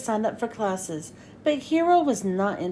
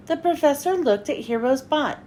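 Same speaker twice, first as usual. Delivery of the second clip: ordinary delivery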